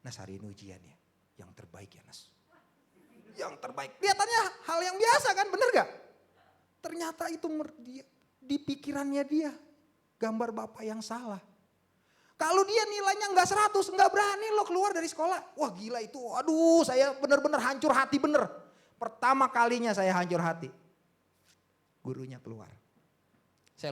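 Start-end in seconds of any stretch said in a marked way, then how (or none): 0.0-2.3 low, soft fatherly word; casual gentle reminder. Retold or imitated. Father sending child to an exam, casual gentle reminder. 3.4-20.7 loud, high, impassioned testimony; full-force preaching. Real, not acted.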